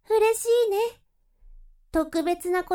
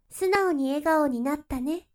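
usual speaking voice

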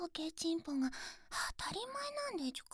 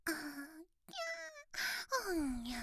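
whiny infant wail; baby crying sounds; 3 dB quieter than usual. Acted. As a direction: mewl like a newborn. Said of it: "baby cry imitation".

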